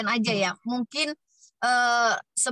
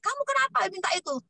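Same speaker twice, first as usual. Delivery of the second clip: imitating the mother; 9 semitones higher than usual. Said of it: "Mamanya bilang begini".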